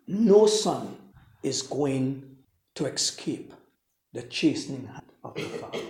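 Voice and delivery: ordinary delivery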